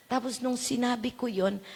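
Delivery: ordinary talk, nothing marked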